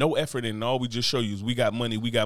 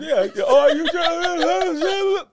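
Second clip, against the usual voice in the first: laughing club yell; 14 semitones higher, 5 dB louder, much slower than usual. Retold or imitated.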